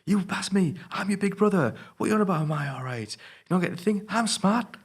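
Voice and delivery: shrill-high mock outburst, hurt and angry; high whiny outburst. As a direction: wail it like a hurt, bitter sibling. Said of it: "he has his outburst".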